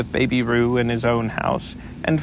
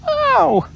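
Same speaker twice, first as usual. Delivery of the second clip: much higher, louder yelp; squeaky ow; 5 dB louder than usual. Acted. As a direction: yelp it while being scrubbed. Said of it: "cried Piglet".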